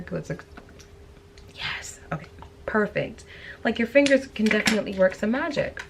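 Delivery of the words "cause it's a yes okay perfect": whispering. Real, not acted. Quiet aside while blending.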